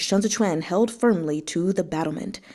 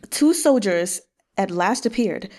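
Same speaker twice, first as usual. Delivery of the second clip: ordinary delivery, nothing marked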